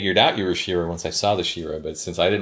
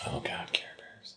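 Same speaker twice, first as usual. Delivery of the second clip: breathy whispered "Oh, God"; whispered groan; 15 dB quieter than usual. Real, not acted. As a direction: whisper it in fond disbelief. Nostalgic groan at memory.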